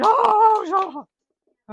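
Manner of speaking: sudden high loud yelp of surprise; startled yelp. Real, not acted. Man startled by a dropped knife, loud yelp. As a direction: jump at the falling knife with a yelp.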